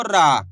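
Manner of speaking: रा with a long stretched aa; drawn-out vowel. Shown as a demonstration. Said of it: "खींच के पढ़ दिया रा".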